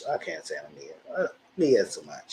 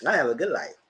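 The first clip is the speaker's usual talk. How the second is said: high mock reply; 6 semitones higher than usual. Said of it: "I said".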